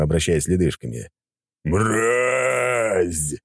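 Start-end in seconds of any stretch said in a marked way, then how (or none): 1.6-3.3 dragon roar. Acted. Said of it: "взревел дракон".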